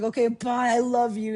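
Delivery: high, loud affectionate call; shouted goodbye. Retold or imitated. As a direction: holler farewell to a departing friend.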